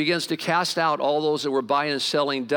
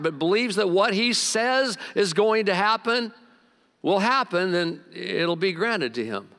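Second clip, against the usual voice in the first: scripture reading; 5 semitones higher than usual. Acted. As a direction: read the passage out with preaching lift. Reads scripture aloud.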